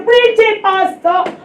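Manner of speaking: high mock cheer of preach it; lightly voiced amen corner; mock congregant. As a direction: imitate an eager church member. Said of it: "you say".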